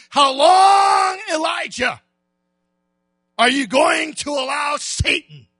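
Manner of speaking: loud, high preaching voice; the preacher rousing the congregation like God rousing Elijah; fiery preaching. Acted. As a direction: shout it out to the church. Preaching at full force.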